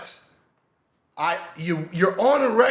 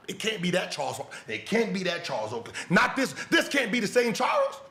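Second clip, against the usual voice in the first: normal talking voice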